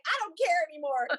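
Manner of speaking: movie line impression. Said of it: "it's like".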